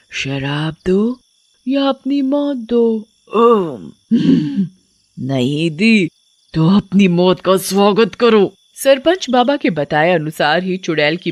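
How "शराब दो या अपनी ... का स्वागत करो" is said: slow, drawn-out threatening witch voice; menacing witch. Acted. Witch demands liquor or death at the door, menacing. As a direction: threaten with lingering, stretched words.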